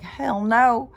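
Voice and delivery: high, loud retold "Hell no"; mock shouted refusal. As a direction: blurt it like the girls flatly refusing. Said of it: "And so these girls were like".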